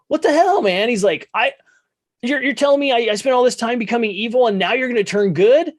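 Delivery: hammed-up outraged whine. Scott whining at his father's change of heart, mock outrage. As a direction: squeal it like a sulky teen.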